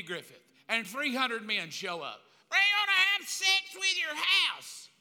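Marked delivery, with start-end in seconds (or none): 2.5-4.9 slow, loud, higher mock crowd holler; rowdy mob. Preacher imagines the mob at his house, slow loud holler. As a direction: drag it out like a rowdy gang.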